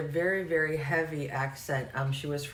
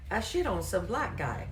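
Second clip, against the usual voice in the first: higher-pitched Arkansas caller imitation; heavy Arkansas accent; 4 semitones higher than usual. Agent retelling what he misheard, thick Arkansas accent. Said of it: "just really heavy accent for me".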